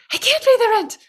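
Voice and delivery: very high pleading damsel voice; damsel in distress. Pretend. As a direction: squeal it like a helpless victim. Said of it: "this is the world's shortest play".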